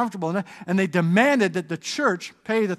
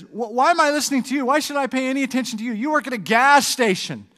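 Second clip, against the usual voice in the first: put-on higher, fast, scoffing young voice; arrogant boy; 7 semitones higher, 6 dB louder, faster than usual. Arrogant boy mocking his guru at the gas station, higher and fast. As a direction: toss it off higher and quicker, full of scorn.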